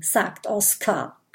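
ordinary delivery

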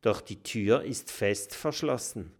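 usual speaking voice

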